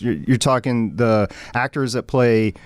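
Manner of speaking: ordinary talk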